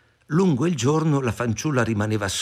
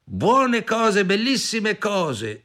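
high, drawn-out sales patter; wheedling vendor advertising treasures; coaxing pedlar woman; 10 semitones higher, much slower than usual. A narrator's reading. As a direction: tempt the buyer with a high, stretched call. Disguised queen hawks her wares.

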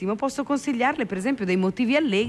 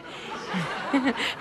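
laugh; 4 semitones higher, 4 dB quieter than usual. Real, not acted. Laughs at own quip.

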